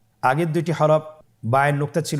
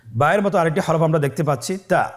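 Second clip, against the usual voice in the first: normal talking voice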